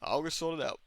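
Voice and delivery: lightly voiced reserved dad; understated, unbothered reply; Craig. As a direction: answer with a hint of quiet reserve. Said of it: "reserved, quiet man ever".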